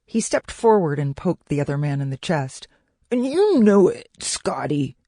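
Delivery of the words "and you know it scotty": high, loud jab easing onto the name; indignant, raised-voice comeback; heated accusation. A narrator's reading. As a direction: throw the blame back hard. Acted quarrel, poking the rival.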